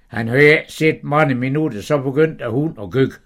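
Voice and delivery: ordinary delivery, nothing marked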